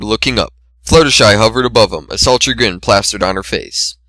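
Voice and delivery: usual speaking voice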